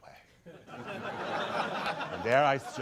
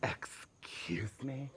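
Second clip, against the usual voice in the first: slow, hushed indignant whisper; whispered excuse me; 3 semitones higher, 9 dB quieter, much slower than usual. Retold or imitated. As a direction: breathe it out in mock offense. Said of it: "And I'm like".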